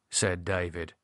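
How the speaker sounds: ordinary delivery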